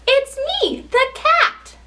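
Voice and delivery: high, delighted-sounding reveal; storybook cat. Acted. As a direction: announce yourself high as the cat. Reader plays the revealed cat.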